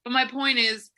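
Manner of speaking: ordinary talk, nothing marked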